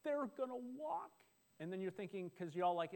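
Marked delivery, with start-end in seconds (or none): 0.0-1.1 much higher, thrilled gasp of delight; a parent amazed at the first steps; excited parent. Retold or imitated. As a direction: marvel as the baby starts to walk. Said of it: "you're like".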